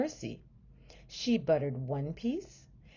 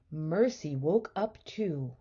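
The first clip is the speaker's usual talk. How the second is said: plain reading voice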